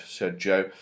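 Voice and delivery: plain reading voice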